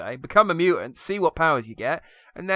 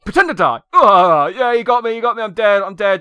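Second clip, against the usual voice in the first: hammed-up fake dying, louder; overacted death scene; 6 semitones higher, 8 dB louder than usual. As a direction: pretend to die, badly and loudly. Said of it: "and he was like".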